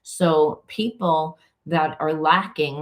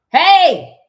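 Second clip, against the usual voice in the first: sudden high loud shout of hey; calling out; 14 semitones higher, 12 dB louder than usual. Real, not acted. Calling to whoever is at the door, loud high shout. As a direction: call out across the house.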